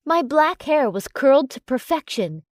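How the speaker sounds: ordinary delivery, nothing marked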